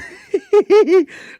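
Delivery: high-pitched giggling laugh; amused giggle. Real, not acted. Vlogger amused at being known too well, high giggle. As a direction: giggle helplessly, high and loud.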